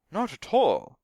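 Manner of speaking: high, loud indignant denial; heated. A narrator's reading. Stunt double denies doing Sandy's job, heatedly. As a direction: deny it hotly, high and loud. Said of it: "he said heatedly".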